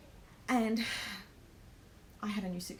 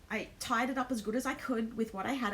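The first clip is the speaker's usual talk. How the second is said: ordinary talk, nothing marked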